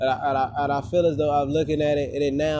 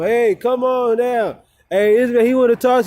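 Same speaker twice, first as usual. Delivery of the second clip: higher, louder call-out, imitating a man yelling; retold yelling; 8 semitones higher, 5 dB louder than usual. Vlogger imitating a man calling someone over, yelling. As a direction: yell it out as a retold holler. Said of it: "recent as far as yelling".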